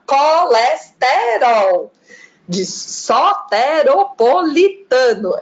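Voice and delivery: "colesterol" and "soteropolitano" slow, every vowel wide open; open Bahian vowels. Shown as a demonstration. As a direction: stretch "colesterol" with open O and E sounds, Bahian style. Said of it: "elas são super abertas".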